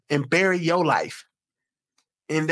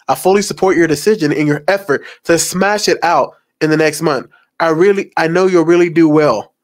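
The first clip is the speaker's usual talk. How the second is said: reading the messages aloud, louder than before; loud reading of texts; 9 dB louder than usual. Acted. To the viewers, reading texts loudly. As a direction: read the texts out, full voice.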